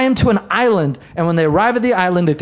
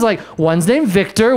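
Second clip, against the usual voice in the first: normal talking voice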